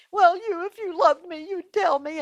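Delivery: high, whimpering fake cry; Delilah. Delilah pressing Samson to tell her, mock-crying. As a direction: keep up the put-on tearful voice. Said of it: "she put on the crying act".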